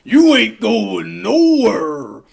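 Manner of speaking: roaring wrestler, slow and loud; Bonesaw. Retold or imitated. Bonesaw threatening Peter in the cage, roaring. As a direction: bellow a drawn-out wrestler threat. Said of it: "He's like".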